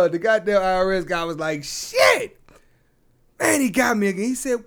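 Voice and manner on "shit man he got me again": squeaky high shocked yelp; high shocked outburst, then deflating; a yelp of loss, high-pitched; dismayed cry. Retold or imitated. IRS guy loses bet.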